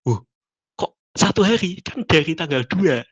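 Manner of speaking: mock taken-aback protest; reenacted surprise. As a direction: replay your own puzzled disbelief. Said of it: "ekspresi saya waktu itu".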